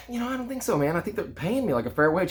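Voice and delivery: imitating his younger self, high-pitched; doubtful protest. Young son pushing back on his dad's warning, doubtful high-pitched protest.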